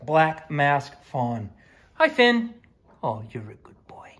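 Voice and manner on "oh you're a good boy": soft whisper. Real, not acted. Owner whispering praise to her dog, whispered. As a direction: whisper the praise close to him.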